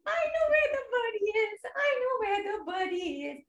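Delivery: high, quick squirrel chatter; speedy little storybook squirrel, gloating; laughing squirrel. Acted. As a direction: rattle it off in a high teasing voice. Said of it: "The squirrel was laughing".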